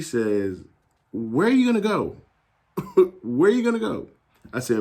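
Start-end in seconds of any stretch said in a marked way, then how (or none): 1.1-4.1 high-pitched mock disbelief, swooping down at the end; incredulous mom. Mom challenging her son's plan to transfer, incredulous high voice. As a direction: imitate a mom's high, incredulous question. Mom's incredulous reply, retold.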